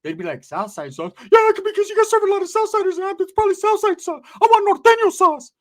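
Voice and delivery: high, loud, demanding falsetto; Ricky ordering. Retold or imitated. Ricky ordering at Foster Freeze in a high, loud falsetto. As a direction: insist on Norteño sauce. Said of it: "Ricky would go".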